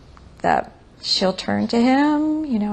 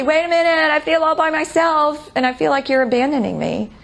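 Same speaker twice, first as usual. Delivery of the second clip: higher, pleading mock call; calling after her; 7 semitones higher, faster than usual. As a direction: act out calling after a partner who is leaving. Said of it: "calling after her, say".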